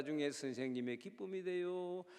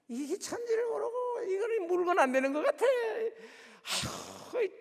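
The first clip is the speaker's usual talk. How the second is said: much higher, laughing and crying out, ending in a long sigh; hammed-up despair over an unruly child; exasperated laughing cry; 14 semitones higher, 5 dB louder than usual. (웃음 섞인 한탄)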